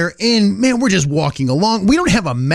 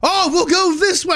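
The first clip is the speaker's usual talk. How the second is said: mock fair character; 9 semitones higher than usual. Costumed boys following.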